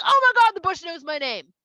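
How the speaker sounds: loud, high mock freak-out; astonished. Moshe hearing the bush call him, astonished. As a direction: exclaim in hammed-up shock.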